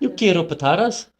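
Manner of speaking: high, lilting Irish-accented Spanish; loud Irish, rising at the end. Shown as a demonstration. Showing co-host Spanish in a high Irish accent. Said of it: "Try and do Irish".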